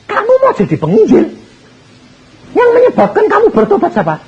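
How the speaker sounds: high, astonished disbelief; can't believe what he's hearing; astonished question. Acted. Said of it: "Tambah bingung lagi".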